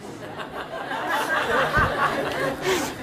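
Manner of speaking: breathy high-pitched laugh; high giggle. Real, not acted. Laughing at his own impression of mom, high and breathy.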